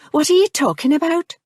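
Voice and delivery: honestly lost, pressing him; confused question. A narrator's reading.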